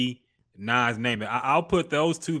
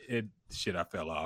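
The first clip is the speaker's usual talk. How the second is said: dropping off; 6 semitones lower, 10 dB quieter than usual. Real, not acted. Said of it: "Nah, I forgot".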